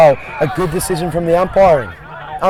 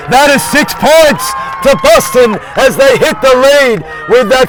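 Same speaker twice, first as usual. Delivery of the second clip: excited goal call; 5 semitones higher, slower than usual. Real, not acted. Commentator calling a Boston goal to the crowd, shouting excitedly.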